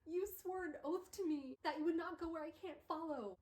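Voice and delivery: high-pitched, dramatized reproach; impassioned plea. Acted. Will holding his dying friend to an oath, impassioned. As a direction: press the oath on them, theatrically.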